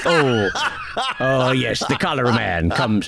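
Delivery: laughing through a mock Welsh pirate accent; a gleeful Welsh pirate menace, half laughing; lightly done Welsh pirate accent with laughter. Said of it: "this kind of Welsh pirate voice".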